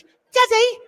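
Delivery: a high mock British kid's voice; posh British child. Host mocking his child self trusting dad, British accent, high. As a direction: play a prim British child adoring his father. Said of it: "and you're British".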